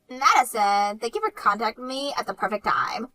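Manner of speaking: usual speaking voice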